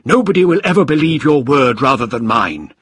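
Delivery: high, loud villain's taunt; defiant shout. A narrator's reading. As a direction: shout down your opponent. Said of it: "shouted Krieger".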